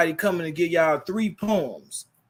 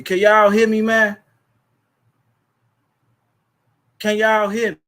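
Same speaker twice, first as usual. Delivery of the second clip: loud, higher call into the mic; louder, higher plea to the chat; 5 semitones higher, 8 dB louder than usual. Real, not acted. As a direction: shout to see if anyone hears. Laptop audio failing, calling out.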